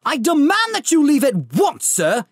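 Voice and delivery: high, loud indignant uncle; pompous demand. Acted. Vernon ordering the giant out, loud and indignant. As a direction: order the intruder out. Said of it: "he said".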